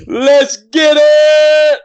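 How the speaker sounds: long, drawn-out excited yell; hype shout. Real, not acted. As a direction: shout it out to the room. Hype shout on joining the call.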